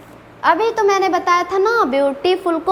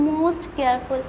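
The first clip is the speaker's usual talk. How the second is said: "most careful" dropped much softer and lower; soft superlative; 5 semitones lower, 7 dB quieter, much slower than usual. Shown as a demonstration. Teacher to students giving the superlative, "most careful" said softly. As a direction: let "most careful" fall away softly. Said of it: "superlative degree में most".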